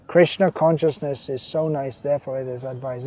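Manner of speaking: ordinary talk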